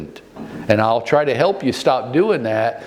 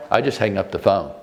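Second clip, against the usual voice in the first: ordinary talk, nothing marked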